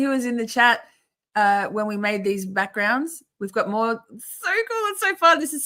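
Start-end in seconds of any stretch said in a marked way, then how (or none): 3.9-5.6 very high, excited squeal; gushing delight. Real, not acted. Streamer showing chat their backgrounds, gushing delight. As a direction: gush in a high-pitched thrill.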